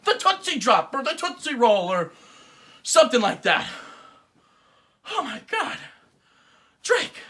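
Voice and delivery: high, loud betrayed cry; mock heartbreak. Betrayed fan addressing [name].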